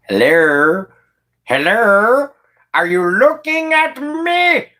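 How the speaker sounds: high, drawn-out goofy voice, rising; mock weirdo call-out. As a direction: climb to a squealed, comic question. Mimes gawkers at a weirdo.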